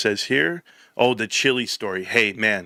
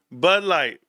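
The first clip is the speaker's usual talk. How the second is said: ordinary delivery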